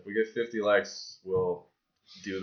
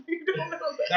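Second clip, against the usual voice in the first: high, laughing tease; 4 dB louder than usual. Real, not acted. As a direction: giggle the words out. Laughing teasing reply.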